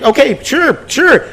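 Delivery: high sarcastic. Retold or imitated. Sarcastic retold agreement.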